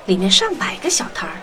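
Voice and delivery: ordinary delivery, nothing marked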